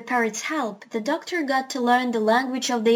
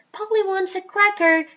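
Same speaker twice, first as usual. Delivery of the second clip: higher-pitched parrot stock phrase; 9 semitones higher than usual. A narrator's reading. Parrot demonstrating people's language, high-pitched. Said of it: "If I said".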